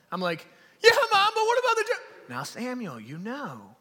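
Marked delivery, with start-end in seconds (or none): 0.8-2.3 put-on loud, very high-pitched kid's yell; mock panicked protest. Scared boy interrupting mom's verse, loud high mock-panicked protest. 2.3-3.7 soft, low Alabama drawl; mom's Alabama accent. Retold or imitated. Alabama mom addressing her son, soft Southern accent. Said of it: "this, this accent".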